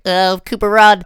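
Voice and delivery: high, loud mock call to run; mock shout. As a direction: yell it like a cartoon cheer to flee. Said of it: "That's like".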